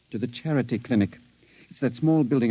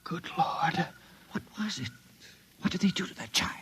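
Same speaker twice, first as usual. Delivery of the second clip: whisper, aghast; horrified whisper; 7 semitones higher, 8 dB quieter than usual. Acted. Patient whispers to his friend in horror at the window. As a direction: whisper in shock.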